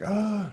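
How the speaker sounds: high mock groan of frustration; frustrated groan. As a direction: let out an exasperated "ah". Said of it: "I'm getting frustrated".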